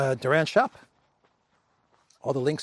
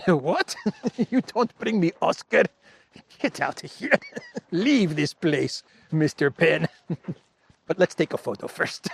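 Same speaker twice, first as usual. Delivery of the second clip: higher mock Ukrainian accent broken by laughter; Zelensky voice; 5 semitones higher than usual. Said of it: "my Zelensky voice".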